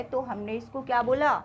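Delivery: ordinary talk, nothing marked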